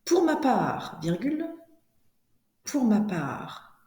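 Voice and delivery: slow, carefully articulated chunks with long pauses; classroom dictation. Shown as a demonstration. Teacher dictates to pupils writing it down, slow deliberate dictation. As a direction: dictate phrase by phrase, punctuation spoken aloud.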